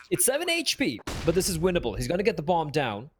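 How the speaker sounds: rising hype at a clutch; excited. Real, not acted. Clutch moment in the round.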